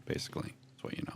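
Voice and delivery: hushed secret aside; whispered. A narrator's reading. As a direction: drop to a whisper to reveal it. GM hushes the key fact.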